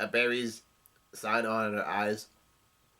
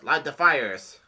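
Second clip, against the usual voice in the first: louder, moderately raised command; yelled order; 3 semitones higher, 7 dB louder, slower than usual. A narrator's reading. Somebody in the flashback orders fires lit, moderate yell. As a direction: read it as a short called-out order. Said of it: "somebody yells out".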